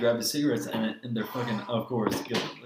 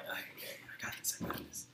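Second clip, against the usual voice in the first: much softer, breathless whisper to yourself; sneaky whisper; 4 semitones lower, 18 dB quieter than usual. Retold or imitated. Whispering to himself while pocketing a stolen cigarette. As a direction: hush it like you're pulling off a heist.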